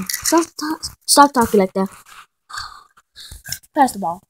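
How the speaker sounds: usual speaking voice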